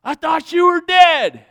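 loud, high, drawn-out shock; startled Satan. Acted. Satan seeing Jesus alive, startled high cry. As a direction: cry out in alarmed disbelief. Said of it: "And Satan goes".

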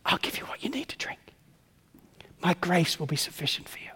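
soft, tender whispered reassurance; voice of God. Acted. God answering the thirsty believer, soft whisper. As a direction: whisper comfort to a tired child. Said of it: "And God says".

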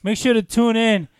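loud, high announcer-style sign-off; mock sign-off. Teased co-host pretends to end the show early, loud mock sign-off. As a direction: belt out a pretend show outro.

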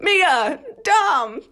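high mock yells, not full volume; imitated shouting. Mimicking the truck-chase shouting for a friend, high mock yells. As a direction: call out names like frantic film characters, playfully. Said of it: "people shouting, like".

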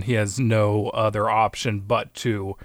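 usual speaking voice

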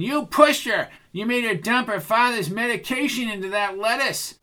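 high, agitated accusation; hot-headed high-voiced outburst; Bucky, heated accuser. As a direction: throw the charge at him, pitched high.

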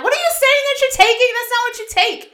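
high-pitched yelled scolding, imitating mom; mom yelling. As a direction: call out a correction from across the room. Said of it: "yelling in the background".

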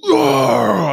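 loud high strained groan; loud strained cry of release; climax groan. Retold or imitated. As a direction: let out a sudden loud groan. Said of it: "I was like".

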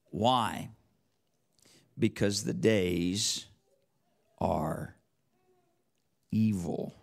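solemn recitation of scripture; slow scripture reading. Acted. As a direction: let each phrase of the verse land. Reads rest of verse slowly.

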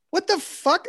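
high, disbelieving what the fuck; exasperated disbelief at the article; incredulous. Retold or imitated. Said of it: "And you're like".

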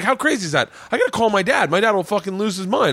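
high-pitched, astonished retold reaction; amazed excitement. Comic tells biker about his dad, excited. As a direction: exclaim it high, thrilled by the coincidence.

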